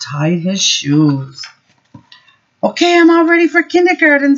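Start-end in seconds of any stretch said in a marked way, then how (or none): 2.6-4.4 put-on high, fast, excited child voice; a small boy bursting to leave; Henry's voice, much higher and quicker; eager little boy.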